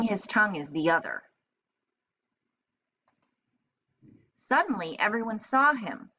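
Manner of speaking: lively read-aloud storytelling voice; story narration. A narrator's reading. Teacher reading a picture book to children, lively. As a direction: read it brightly to young kids.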